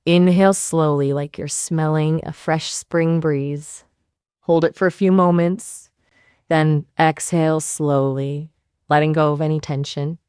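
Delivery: soothing breath coach; guided breathing. Acted. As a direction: talk them down gently, like a meditation guide. Leads a breathing exercise.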